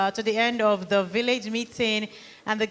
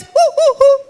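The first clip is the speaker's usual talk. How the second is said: hooting call; 11 dB louder than usual. Retold or imitated. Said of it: "The lady went".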